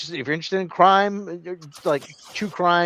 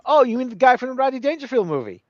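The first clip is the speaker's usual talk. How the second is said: mock innocent question; 10 semitones higher, 3 dB louder than usual. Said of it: "I said".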